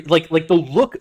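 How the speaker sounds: ordinary talk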